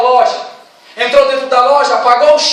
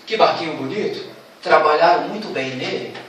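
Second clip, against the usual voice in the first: put-on much lower, soft old fisherman; an admiring old fisherman, low and hushed; 9 semitones lower, 8 dB quieter than usual. Fisherman finding the boat on the shore, low and admiring.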